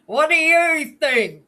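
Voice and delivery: loud, high, drawn-out retort; teasing comeback. Real, not acted. Guest teasing co-host about their speech habit, gleeful. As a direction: throw it back teasingly, slow and high.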